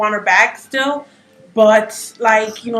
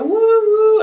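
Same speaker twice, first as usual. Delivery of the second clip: imitated faint cheers; 10 semitones higher than usual. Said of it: "people in the back were".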